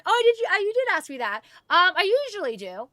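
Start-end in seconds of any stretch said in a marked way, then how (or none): none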